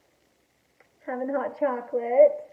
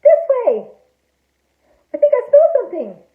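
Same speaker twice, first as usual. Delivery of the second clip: high, loud excited call; urgent tracking; 11 semitones higher, 9 dB louder than usual. A narrator's reading. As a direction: call out as a detective on the scent. Shark following a scent.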